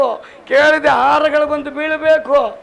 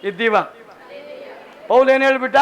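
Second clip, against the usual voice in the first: normal talking voice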